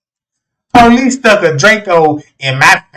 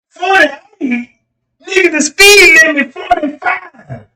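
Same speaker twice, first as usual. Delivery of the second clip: high-pitched incredulous protest; hammed-up indignation; mock disbelief; 7 semitones higher, slower than usual. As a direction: act out a driver crying foul, voice way up. Acts incredulous driver arguing.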